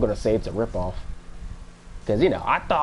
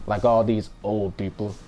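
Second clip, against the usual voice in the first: ordinary talk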